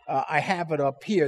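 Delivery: ordinary talk, nothing marked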